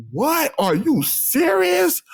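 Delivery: high, drawn-out incredulous question; retold disbelief. Retells his shocked reaction.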